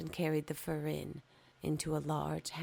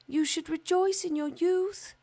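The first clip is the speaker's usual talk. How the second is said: much higher and louder, glowing; sunbeams voice; 13 semitones higher, 8 dB louder than usual. Acted. Sunbeams urging the young fir to rejoice, high and bright-toned. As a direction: shine the words out high.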